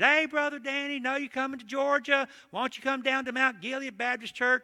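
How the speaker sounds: high-pitched, hearty country preacher voice; country pastor friend. Retold or imitated. Country pastor inviting his friend to preach, imitated high and folksy. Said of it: "That's how he talked".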